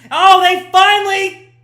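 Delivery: sudden loud, high exclamation; excited outburst. Real, not acted. Commentator spots Vietnam finally getting forward, excited shout. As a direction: burst out as play suddenly happens. Sudden action in live play.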